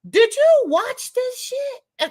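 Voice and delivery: high, slow, stunned question; incredulous. Real, not acted. Asking the viewers, high and stunned. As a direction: demand it in disbelief.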